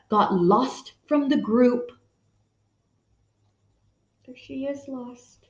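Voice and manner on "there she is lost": very soft pointing-out; softly showing the picture; hushed aside. Real, not acted. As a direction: whisper it toward the page.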